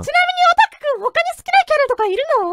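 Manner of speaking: very high, fast cutesy question; high anime girl voice. Acted. Girl in anime voice asks the otaku his favorite character. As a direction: ask sweetly in character. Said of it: "サーニャちゃんのコスプレ".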